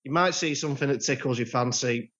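normal talking voice

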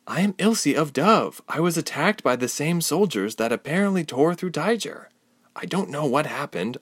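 put-on a frightened girl trying to sound composed; young Ilse, earnest.

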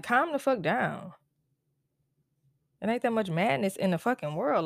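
ordinary talk, nothing marked

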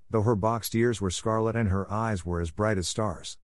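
plain reading voice

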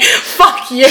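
laughing through a mock fuck you; laughing retort. Laughing back at her brother. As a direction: toss it off while laughing. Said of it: "And I'd just be like".

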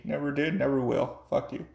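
normal talking voice

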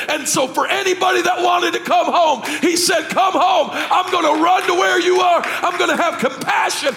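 high, shouted sermon climax; impassioned preaching. Acted. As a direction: build to a full-throated gospel shout. Preaching at full force.

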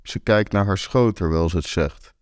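ordinary delivery, nothing marked

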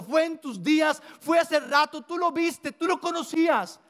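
mucho más agudo, enfático, reprochando; increpando con pasión. Real, not acted. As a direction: reclama a Pablo con fuerza. Predicador increpa a Pablo. (le grita a Pablo, predicando con pasión)

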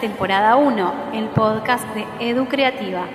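formal boarding call over the PA; airport announcement. Acted. Podcast intro as flight boarding call.

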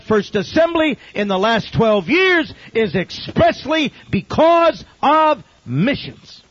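loud, high-pitched proclamation, slowing heavily toward the end; impassioned testimony. Retold or imitated. Stranger testifying to the pastor about missions, loud and impassioned. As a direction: imitate a man forcefully declaring his testimony.

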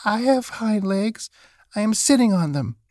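high-pitched insistent rabbit; protesting little rabbit. A narrator's reading. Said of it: "cried the little rabbit".

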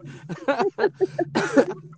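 high laugh. Real, not acted. Laughing at the memory.

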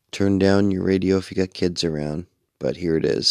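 ordinary talk, nothing marked

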